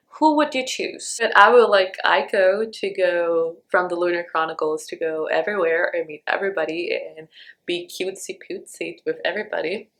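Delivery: ordinary talk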